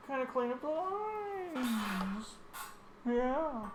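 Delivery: high and slow, toying with it; high silly voice. Acted. Artist talking to herself in a high silly voice. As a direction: stretch it out high.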